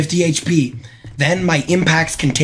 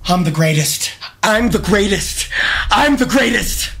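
from usual pitch up to a high exultant cry; triumphant boasting, building; 4 semitones higher than usual. Real, not acted. Streamer crows over his clutch win, rising to a high cry. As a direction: crow it higher with every repeat.

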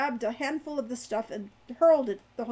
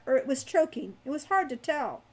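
ordinary delivery, nothing marked

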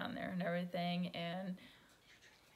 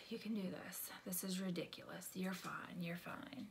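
soft whisper to herself; whispered self pep talk; 10 dB quieter than usual. Retold or imitated. Calming herself before meeting people, whispered pep talk. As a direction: coax yourself under your breath.

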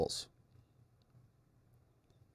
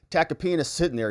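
ordinary delivery

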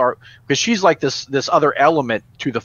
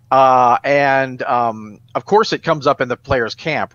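ordinary talk

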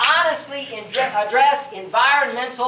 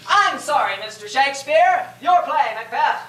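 mock condescension; 4 semitones higher than usual. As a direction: talk down to the great playwright. Mock critic addressing Shakespeare.

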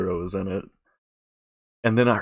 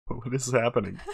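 laughing; 8 dB quieter than usual. Real, not acted. Laughing at a weird clip.

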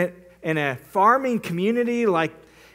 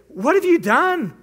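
high, louder, panicked reproach; alarmed accusation; 7 semitones higher, 4 dB louder than usual. Retold or imitated. Three thousand villagers confront Samson, panicked and accusing. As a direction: scold him in fright.

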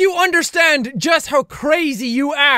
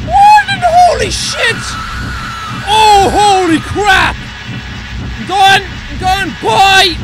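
startled panic; 3 semitones higher, 4 dB louder than usual. Real, not acted. Gamer jumping at a scary stranger, loud panicked shouting. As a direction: yell out in real fright.

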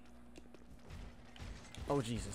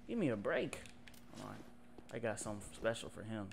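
normal talking voice

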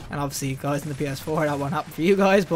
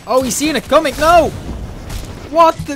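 startled outcry; 8 semitones higher, 7 dB louder than usual. Real, not acted. Ambush fails.

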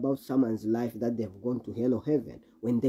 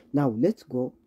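normal talking voice

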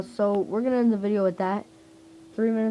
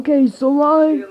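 put-on slow, loud old grandpa voice; a hint of a creaky old man; 7 semitones higher, 12 dB louder, slower than usual. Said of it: "trying to get out of the grandpa voice".

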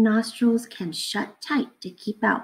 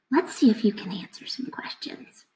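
playful hushed whisper; 3 semitones higher, 5 dB quieter than usual. Acted. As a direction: invite the quiz in a hush. Teacher starting quiz quietly.

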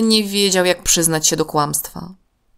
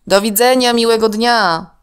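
a lightly voiced father, higher and louder; dad's goodbye; 7 semitones higher, 6 dB louder than usual. A narrator's reading. Alek's dad sending the children off, a lightly voiced goodbye. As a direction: bid the children a friendly goodbye. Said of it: "powiedział tata Alka".

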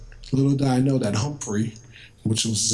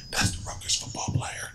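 hushed, voiceless whisper from passersby; 7 dB quieter than usual. Retold or imitated. Hallway voices whispering about the new patient. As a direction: breathe it like gossip behind his back. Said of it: "Like whispering".

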